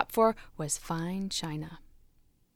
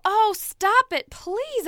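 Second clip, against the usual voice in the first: higher, louder, slow begging; pleading cry; 10 semitones higher, 5 dB louder, slower than usual. Acted. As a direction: beg the master to stop. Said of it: "I cried".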